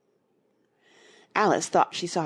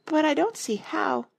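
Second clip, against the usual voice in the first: puzzled; 12 semitones higher than usual. A narrator's reading. Alice puzzled.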